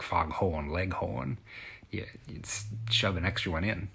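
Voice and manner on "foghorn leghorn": "foghorn leghorn" with both R's dropped, drawn out; slow non-rhotic "foghorn leghorn", no R sounds. Shown as a demonstration. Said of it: "You don't drop it".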